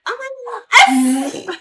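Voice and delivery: put-on high, loud whiny baby cry; imitating baby. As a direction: whimper like a kicked baby. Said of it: "It's the little baby being like".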